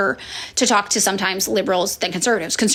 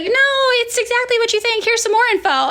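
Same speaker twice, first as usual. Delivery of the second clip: much higher, mock know-it-all; eager confirmation; 13 semitones higher than usual. Someone giving more info, high eager mock voice. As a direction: hand over the proof eagerly.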